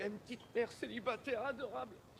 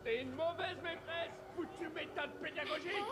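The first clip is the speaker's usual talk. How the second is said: high, indignant shouted scolding; angry ranting; 7 semitones higher than usual. Acted. Locked-out man berates the teacher, ranting angrily. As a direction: berate her through the shut door.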